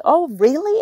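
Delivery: high sarcastic "oh really"; mock surprise. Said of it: "And then I just said".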